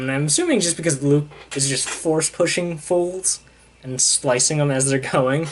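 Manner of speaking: normal talking voice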